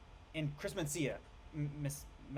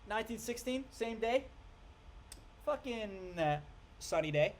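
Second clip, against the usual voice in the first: higher and louder, slow, goofy mockery; dopey mock voice; 8 semitones higher, 3 dB louder than usual. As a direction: ham up the clueless guy's sunny logic. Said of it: "And he's like".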